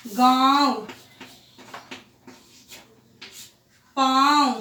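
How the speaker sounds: each word with a long nasal vowel, spaced out; nasalised demo. Shown as a demonstration. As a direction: send the air through nose and mouth. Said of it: "मुंह से भी हवा निकल रही है".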